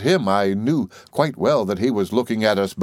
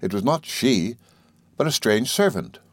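ordinary delivery

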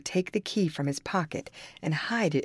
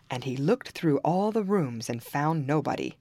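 usual speaking voice

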